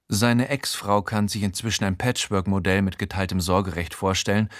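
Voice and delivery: plain reading voice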